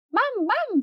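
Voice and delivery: delighted cry. Acted. Girls greeting Mum at the pool, high happy calls. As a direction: call out thrilled to see her.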